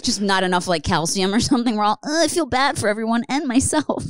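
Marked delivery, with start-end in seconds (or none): 2.0-4.0 mock whiny voice. Mocking Philly people's feelings.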